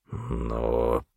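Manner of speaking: low, quiet, drawn-out wavering; unsure, voice dropped right down; hesitant. A narrator's reading. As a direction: trail out a reluctant objection. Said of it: "заколебался он".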